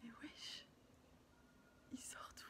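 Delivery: hushed whisper. Real, not acted. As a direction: whisper so nobody wakes. Chuchote dans la chambre la nuit.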